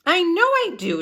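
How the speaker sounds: high, loud protest; girl crying out in anger; cried out. A narrator's reading. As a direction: shout it back stubbornly. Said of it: "Sheila cried".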